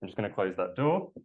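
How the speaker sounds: ordinary delivery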